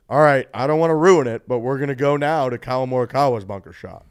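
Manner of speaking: a hint of British broadcaster, loud; Nick Faldo. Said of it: "apparently he transitioned by saying".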